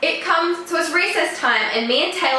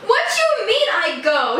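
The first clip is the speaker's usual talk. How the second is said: high-pitched mock indignant question; imitating someone taken aback; retold indignation; 8 semitones higher than usual. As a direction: act out an offended comeback, pitched well up. Creep objects indignantly in retelling.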